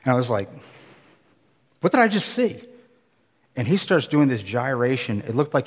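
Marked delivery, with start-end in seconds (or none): 1.8-2.6 high-pitched retold astonishment; stunned disbelief. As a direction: replay a startled inner question, pitched high. Stunned reaction to a strange sight.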